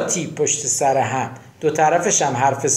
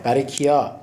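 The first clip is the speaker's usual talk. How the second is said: ordinary talk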